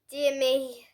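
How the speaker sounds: usual speaking voice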